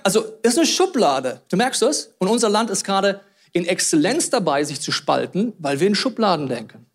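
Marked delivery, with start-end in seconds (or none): none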